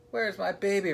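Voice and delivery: high-pitched insistent mother; demanding mother. A narrator's reading. Captain's wife asking for her baby, insistent. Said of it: "repeated Doris".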